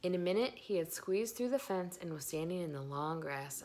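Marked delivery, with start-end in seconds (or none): none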